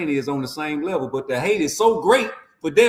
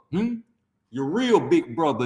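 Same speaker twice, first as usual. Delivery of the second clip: ordinary talk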